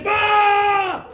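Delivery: very high, loud yell; scaring shout. Retold or imitated. Angry dad running out at the cats, imitated high yell. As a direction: bellow to frighten off an animal. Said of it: "to scare the cats off".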